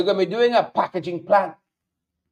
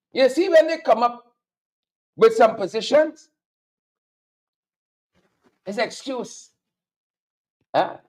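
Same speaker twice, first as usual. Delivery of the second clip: normal talking voice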